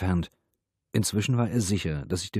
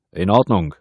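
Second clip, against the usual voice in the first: loud placating shout; raised, carrying voice; calling back; 10 dB louder than usual. A narrator's reading. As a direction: shout agreement back into the flat. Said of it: "rief der Kommissaris zurück".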